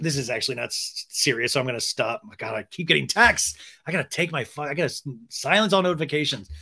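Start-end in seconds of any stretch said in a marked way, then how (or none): none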